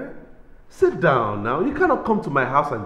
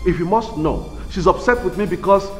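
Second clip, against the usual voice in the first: furious shouting; 9 semitones higher, 8 dB louder than usual. Acted. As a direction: blame her at full volume. Husband shouts at wife.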